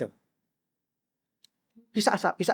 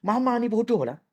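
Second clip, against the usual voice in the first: put-on child's insult; 6 semitones higher, 4 dB quieter than usual.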